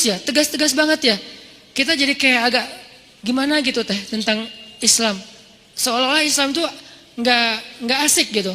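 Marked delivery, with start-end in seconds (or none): none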